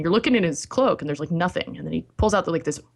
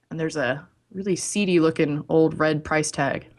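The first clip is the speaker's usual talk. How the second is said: ordinary talk, nothing marked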